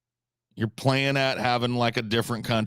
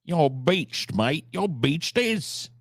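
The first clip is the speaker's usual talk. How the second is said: high, slow, exaggerated Kiwi voice; cartoon Kiwi, pitched way up; mock New Zealand accent; 8 semitones higher, much slower than usual. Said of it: "Beached as is New Zealand".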